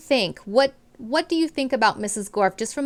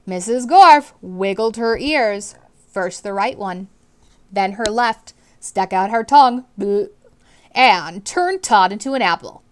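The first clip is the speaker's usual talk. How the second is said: clear, expressive read-aloud to a class; storybook reading voice; reading to children; 6 dB louder than usual. A narrator's reading. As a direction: read it out like a teacher sharing a book. Teacher narrating chapter to class.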